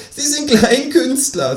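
high, slow, gleeful kangaroo. Acted. The kangaroo labels him a cabaret artist, high and slow. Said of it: "sagt das Känguru".

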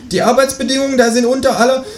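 a cheeky kangaroo asking for eggs. Acted. Kangaroo voiced in read story. (das Känguru bittet um Eier, mit hoher Stimme)

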